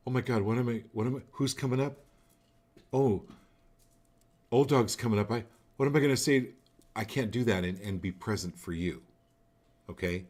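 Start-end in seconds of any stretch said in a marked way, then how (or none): 0.1-6.5 mock panic. Mocks own frantic inner worry.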